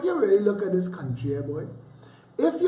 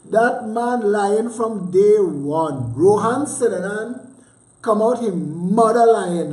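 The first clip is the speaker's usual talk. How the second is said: indignant rant; 2 semitones higher, 7 dB louder, slower than usual. Real, not acted. Ranting at the minister's claims.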